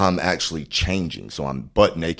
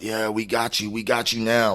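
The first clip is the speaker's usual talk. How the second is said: gloating cop; 6 semitones higher than usual. Retold or imitated.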